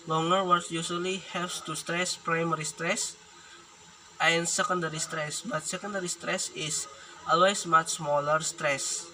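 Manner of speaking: ordinary talk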